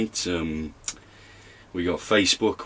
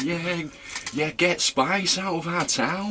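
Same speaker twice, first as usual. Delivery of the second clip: much higher, squeaky imitation of mum; mock mum voice; 11 semitones higher than usual. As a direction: play mum in a high put-on voice.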